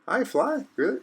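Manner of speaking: I fly? rising high in surprise; surprised question. Shown as a demonstration. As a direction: ask I fly? in high disbelief.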